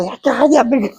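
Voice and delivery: high, laughing brush-off; telling him to shut up through laughter; imitated girl laughing while snapping back; laughing retort. Girlfriend retorts to jealous boyfriend.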